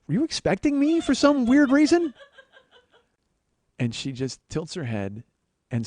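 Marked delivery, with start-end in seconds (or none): 0.1-2.1 high, loud mock outburst; exasperated inner yell. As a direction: yell the incredulous question, pitch shooting up. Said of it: "In my head, I'm like".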